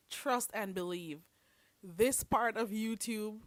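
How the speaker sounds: ordinary talk, nothing marked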